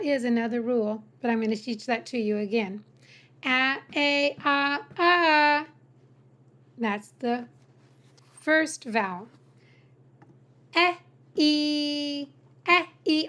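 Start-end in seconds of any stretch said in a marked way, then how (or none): 3.4-5.7 "A, A, ah, ah" one by one, last held; four vowel sounds. Shown as a demonstration. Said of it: "The letter A has four sounds". 10.8-13.3 "E" short as in egg, then long as in me; two E sounds. Shown as a demonstration. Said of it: "Only two sounds".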